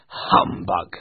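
low, grumpy dismissal; Scrooge. Acted. Scrooge scoffing at Christmas, grumpy. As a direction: throw it out with low contempt. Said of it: "said Scrooge".

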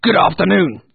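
loud, high, abrupt dismissal; dismissive Scrooge. Acted. Scrooge sending his nephew away, dismissive. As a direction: shout him out the door. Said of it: "said Scrooge".

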